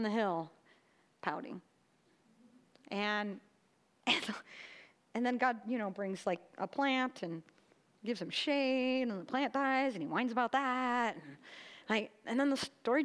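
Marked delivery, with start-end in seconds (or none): none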